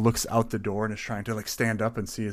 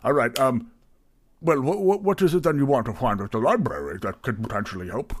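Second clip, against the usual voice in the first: stammering, eager host; bumbling innkeeper, pitch bouncing; flustered tavern keeper; 4 semitones higher, 6 dB louder than usual. Acted. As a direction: fuss and backtrack while welcoming a customer. Plays Barry, flustered tavern keeper.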